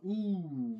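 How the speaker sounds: normal talking voice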